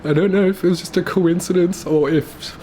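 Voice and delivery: fighting tears through it; holding back tears. Real, not acted. Telling viewers how Mum died, voice breaking up. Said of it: "I need to get myself together".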